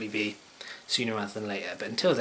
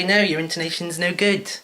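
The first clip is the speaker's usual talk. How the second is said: stern interviewer; 7 semitones higher, 6 dB louder than usual. Retold or imitated. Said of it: "they'd say".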